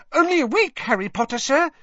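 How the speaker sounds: very high, squeaky happy elf; happy Dobby. A narrator's reading. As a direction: pipe it up gleefully, high and loud. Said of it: "said Dobby happily".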